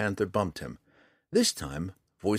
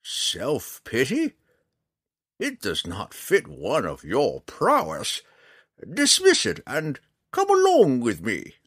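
slow scolding, rising high and loud at the end; undaunted old ranger; 6 semitones higher, 5 dB louder, slower than usual. Acted. Old ranger urging the drow to come along, undaunted. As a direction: scold slowly, then order him along.

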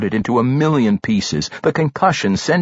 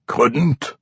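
weakly strained answer; struggling, feeble dwarf voice; 3 semitones higher than usual. A narrator's reading. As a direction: force it out feebly. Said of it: "Pwent answered weakly".

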